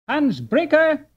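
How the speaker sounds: high, loud call across a distance. A narrator's reading. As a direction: call after him as he walks away. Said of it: "Peter called out".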